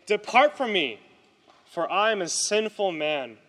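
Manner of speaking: loud, emphatic plea. A narrator's reading. Simon Peter falls before Jesus, loud plea. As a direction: cry it out forcefully.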